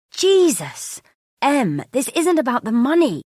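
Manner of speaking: high, loud angry outburst; exasperated shout. Acted. Husband cutting off his wife's argument, exasperated. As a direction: cut in, fed up, shouting.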